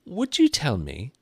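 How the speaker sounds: put-on high, hesitant girl's voice; mildly timid, high-pitched request; a little timid. A narrator's reading. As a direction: ask tentatively with a pause after the first word. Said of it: "said Alice a little timidly".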